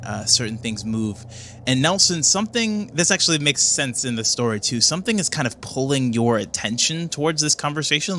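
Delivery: ordinary delivery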